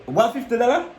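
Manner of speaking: mock outraged quote. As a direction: squeak out the price like she's shocked. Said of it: "She said".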